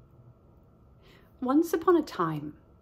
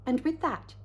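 ordinary delivery, nothing marked

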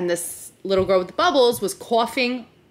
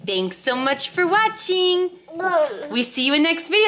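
put-on baby talk; 8 semitones higher than usual. Shown as a demonstration. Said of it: "you say".

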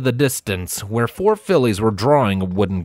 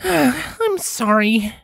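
high, very slow, defeated apology; dejected Starlight; much slower than usual. A narrator's reading. Starlight apologizing to the rover, slow and defeated. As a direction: let it fall in defeat. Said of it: "Starlight said dejectedly".

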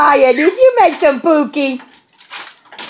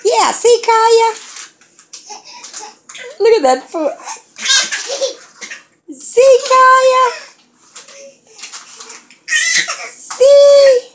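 excited cheer; 12 semitones higher than usual. Real, not acted. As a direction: cheer her on in a high voice. High cheering at baby.

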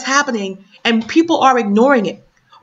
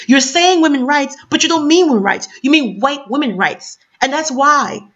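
ordinary talk, nothing marked

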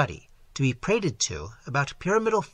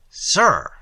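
sharp warning; 3 semitones higher, 8 dB louder than usual. Acted. Glyndon confronting Nicot.

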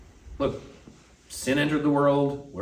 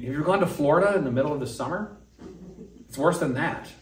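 ordinary delivery

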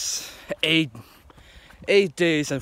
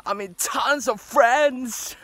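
boastful; 9 semitones higher, slower than usual. Real, not acted. Bragging about friends.

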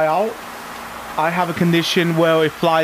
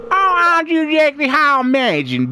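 villager voice; 8 semitones higher than usual. Acted. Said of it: "the voices".

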